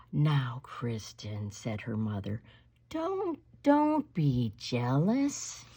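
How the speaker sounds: slow, low, soft scolding; low patient don't be jealous; calm mother. Acted. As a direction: soothe a jealous child slowly.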